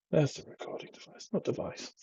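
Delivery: whispered aside, finding the recording device. Real, not acted. Vlogger with baby asleep on him, whispering.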